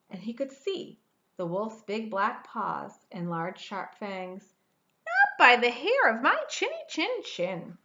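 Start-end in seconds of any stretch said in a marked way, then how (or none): 5.1-7.8 high, loud refusal; squeaky defiant pig; defiant little pig. A narrator's reading. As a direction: refuse him, not fooled. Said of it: "said the second little pig".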